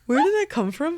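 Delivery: high, startled question; alarmed. Real, not acted. Alarmed at stain on arm.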